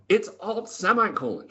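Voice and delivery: put-on high, matter-of-fact old lady voice; squeaky granny voice stating the obvious. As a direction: imitate her setting him straight. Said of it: "she says".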